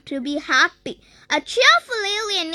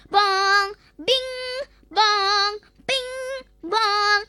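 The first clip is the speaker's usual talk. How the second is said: imitated bouncing; 4 semitones higher, much slower than usual. A narrator's reading. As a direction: sing out each bounce like a chime. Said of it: "He bounced so much".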